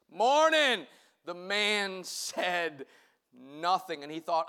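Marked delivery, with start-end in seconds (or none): 0.1-0.9 trying to sound gruff and tough; a put-on gravelly greeting; deep gravelly voice. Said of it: "Tried to get a very deep, gravelly voice".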